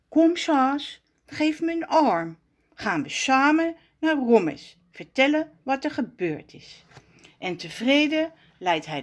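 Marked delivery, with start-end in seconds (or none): none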